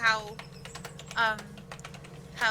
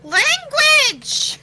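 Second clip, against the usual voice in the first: scolding shout; 14 dB louder than usual. Acted. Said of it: "looks at him and goes".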